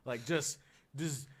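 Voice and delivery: normal talking voice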